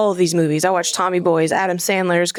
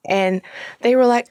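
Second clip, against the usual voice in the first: normal talking voice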